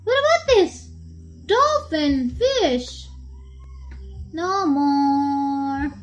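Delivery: animated sing-song picture-book voice; teaching a small child. Acted. Mother naming animals for child, playful sing-song. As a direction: swoop up and down on each animal name.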